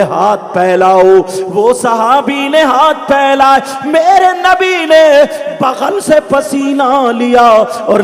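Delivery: projected, chant-like sermon storytelling; rousing Urdu preacher's narration with swelling peaks; full-force preaching. Acted. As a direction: carry each phrase out to the crowd, stretching the endings.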